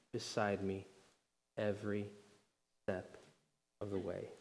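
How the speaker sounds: soft, low, with long pauses; tender reassurance. Acted. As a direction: let each phrase settle gently, almost hushed. Comforting psalm quoted as reassurance.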